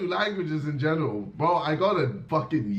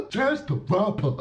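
mock fake Chinese; 3 semitones higher than usual. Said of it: "I'm just copying my man".

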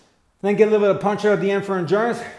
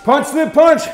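higher, loud rhythmic combo call; shouted command; 6 semitones higher, 6 dB louder, slower than usual. Real, not acted. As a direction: bark out the combo. Coach calling out combos.

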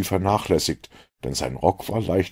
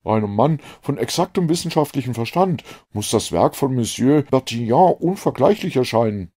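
put-on slightly higher, earnest young doctor; a keen, relieved young man; a lightly voiced eager doctor; 6 semitones higher, 3 dB louder than usual. A narrator's reading. Relieved doctor to Holmes, slightly raised voice.

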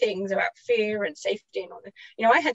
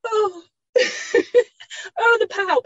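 hurt; 10 semitones higher, 3 dB louder, slower than usual. Retold or imitated. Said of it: "And I was like".

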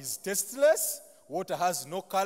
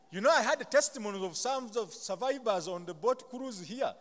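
ordinary delivery

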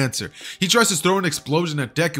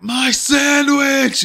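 much higher, louder, stretched-out shriek; crazed scream; 13 semitones higher, 6 dB louder, much slower than usual. Acted. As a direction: lose it completely over the sandwich. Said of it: "Deku just goes crazy".

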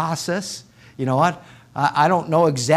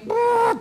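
high, held train sound; imitated train noise. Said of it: "You know, trains".

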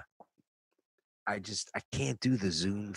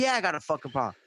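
higher-pitched, defiant comeback; mildly acted belligerence; retold combative retort; 5 semitones higher, much faster than usual. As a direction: act out squaring up to a driver. Retells his retort in the argument.

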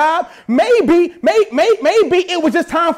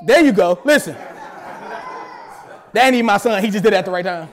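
ordinary delivery

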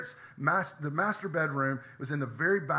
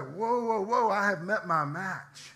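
slow, drawn-out whoa, lightly voiced; startled dog; 4 semitones higher, slower than usual. Pastor voicing his dog startled by its reflection, slow and awed. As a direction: pull back like a dog meeting its match. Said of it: "and he's like".

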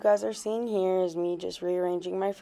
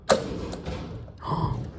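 surprised gasp; 5 dB quieter than usual. Real, not acted. Opening surprise package.

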